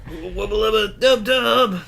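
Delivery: Rick voice. Pretend.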